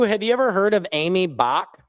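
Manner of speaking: ordinary talk, nothing marked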